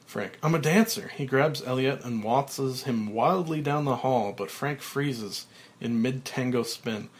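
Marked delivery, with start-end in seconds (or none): 0.4-1.1 giddy. Acted. Giddy Frank.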